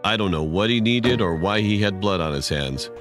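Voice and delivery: ordinary delivery, nothing marked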